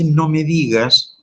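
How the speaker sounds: high, slow, delighted gasp of surprise; pleased astonishment at the friend's news; surprised. Shown as a demonstration. Friend hears Margarita bought a car, surprised delight. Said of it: "denota sorpresa".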